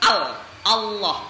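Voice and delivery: al, Allah with a clear heavy L, not au; correct heavy Allah. Shown as a demonstration. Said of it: "tapi al".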